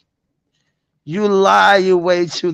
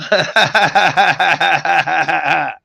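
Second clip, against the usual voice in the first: drawn-out rolling chuckle; a long, steady run of ha-ha-ha; long laugh. Real, not acted. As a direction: laugh it out in one long string. Laughs at own doubt.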